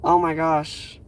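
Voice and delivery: normal talking voice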